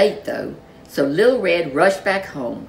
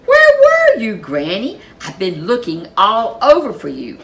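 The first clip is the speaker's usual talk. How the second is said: eager question; 5 semitones higher, 8 dB louder than usual. A narrator's reading. Red asks Granny.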